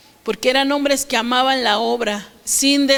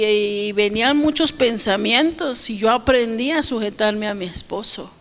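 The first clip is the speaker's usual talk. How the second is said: ordinary talk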